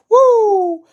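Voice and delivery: loud, very high "woo"; excited whoop. Real, not acted. Streamer cheering a flurry of punches, high loud whoop. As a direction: whoop sharply at the action.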